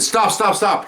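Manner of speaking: high-pitched retold plea to stop; urgent plea. Recruit begs to stop boxing.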